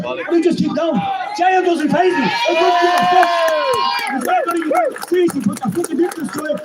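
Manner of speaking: fired-up rally cry; defiant shout. Real, not acted. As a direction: roar it at the crowd. Defiant climax of the speech.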